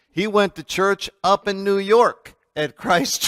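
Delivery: normal talking voice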